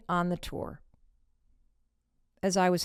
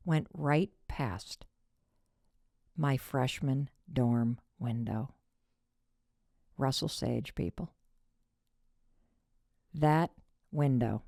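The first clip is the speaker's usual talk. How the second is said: slow dramatic reveal; 5 semitones lower, 4 dB quieter, slower than usual. Real, not acted.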